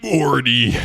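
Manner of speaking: Rick impression. Shown as a demonstration. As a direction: bark Morty like drunk Rick. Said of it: "Wait, I'll do it again".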